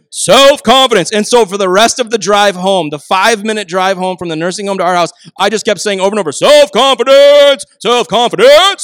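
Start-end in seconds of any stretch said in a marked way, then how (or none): 0.1-1.1 loud, higher-pitched cheer; emphatic proclamation. Retold or imitated. Dad insists on his gift to son, emphatic proclamation. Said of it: "I kept saying it different ways". 6.3-8.8 high, loud, drawn-out chant; louder and louder. Retold or imitated. Dad teasing son all the drive home, loud repeated crowing. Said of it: "louder and louder and louder".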